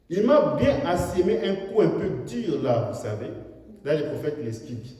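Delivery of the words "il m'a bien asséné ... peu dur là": loud, high fervent sermon delivery; full-force preaching. Acted. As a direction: preach it out to the hall. Preacher retells critic scene.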